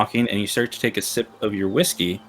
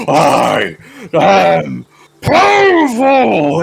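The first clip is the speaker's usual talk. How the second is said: very slow, higher and louder proclamation; triumphant shout; 7 semitones higher, 8 dB louder, much slower than usual. Acted. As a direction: horse bellowing its power. GM voices horse.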